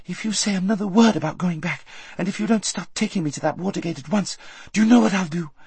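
high, fast, urgent threat; fierce girl making a threat; threatening Aravis. A narrator's reading. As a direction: frighten her into obeying.

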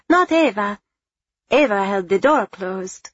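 put-on a boy correcting his father; eager little boy. A narrator's reading. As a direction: pipe up like a small boy. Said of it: "Lorenzo offered up".

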